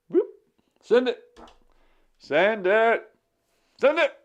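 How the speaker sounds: high, loud excited calls; playful urging. Real, not acted. Streamer urges a chat message to send, excited.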